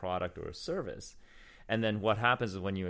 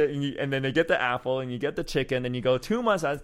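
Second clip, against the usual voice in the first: ordinary talk, nothing marked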